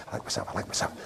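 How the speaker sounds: whispered mantra. Shown as a demonstration. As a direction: whisper it under your breath all day. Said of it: "You go through the day".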